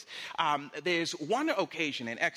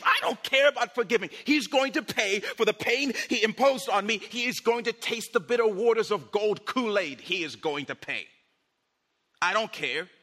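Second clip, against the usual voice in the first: loud, high-pitched furious rant; acted vengeful anger; 5 semitones higher, 6 dB louder than usual. Angry person refusing to forgive, loud and vengeful. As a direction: swear payback at full volume. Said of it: "because I'm mad".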